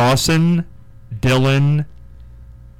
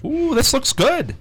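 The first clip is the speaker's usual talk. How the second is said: high, slow delighted ooh; excited; 13 semitones higher, slower than usual. Real, not acted. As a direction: savor the sight of food. Opening the pizza box.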